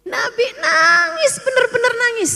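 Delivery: high, loud preaching cry; emotional emphasis. Real, not acted. Preacher stresses the Prophet weeping. (seruan tinggi dan keras penuh haru)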